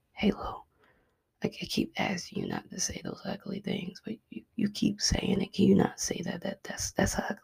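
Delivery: soft whisper right in the ear; God's whisper. Acted. God coming back that evening to correct her, whispered. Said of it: "He's saying it just like that in our ear".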